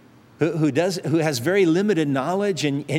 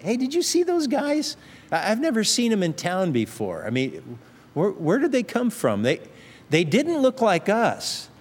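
high, curious gossip voice, pitched up on the first question; a lightly voiced busybody neighbor; nosy townsperson; 6 semitones higher than usual. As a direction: play a suspicious local whispering about strangers. Townspeople gossiping about the strangers.

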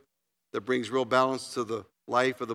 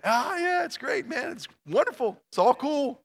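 high, over-bright mock happiness, much higher; fake cheerful; 9 semitones higher than usual. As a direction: pretend all is wonderful, sing-song high. Faking happiness at church.

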